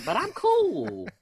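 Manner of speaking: high, loud, drawn-out protest; defensive mock quote. Speaker mocking people confronted with their own bias, high and defensive. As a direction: squeal it slowly in mock self-defence. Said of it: "they're like".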